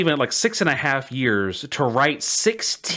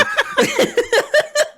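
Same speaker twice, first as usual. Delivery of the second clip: squealing laugh; a high, helpless laugh; high burst of laughter. Real, not acted. Laughing at absurd fanfic.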